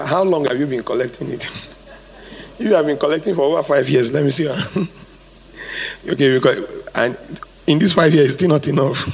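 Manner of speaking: ordinary talk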